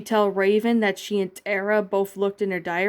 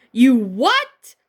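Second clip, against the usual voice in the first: sharp, much louder outburst; scream; 4 semitones higher, 9 dB louder than usual. A narrator's reading. Raven screaming at Starfire over the diary, loud outburst. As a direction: hit it hard and loud in disbelief. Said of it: "Raven screamed".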